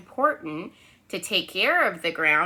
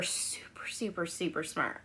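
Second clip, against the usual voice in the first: whisper; 4 semitones lower, 13 dB quieter than usual. Acted. Teacher praising kids secretively.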